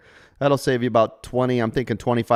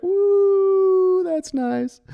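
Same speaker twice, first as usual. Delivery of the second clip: mock woo; much slower than usual. Mock cheer at Diamond Platinum joke.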